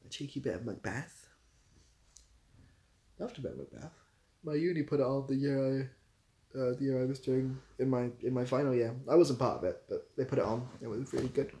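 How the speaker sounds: usual speaking voice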